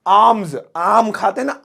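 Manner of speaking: आर्म्स said like आम, "aamz"; silent R. Shown as a demonstration. Teacher likening it to the mango, आर्म्स with silent R. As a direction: start आर्म्स exactly like आम, no R. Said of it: "इसमें आर ना silent".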